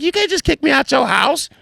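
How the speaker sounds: high falsetto protest; mock indignant woman's voice; mimicked protesting friend. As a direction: object high-pitched, mocking her. Acts friend protesting eviction.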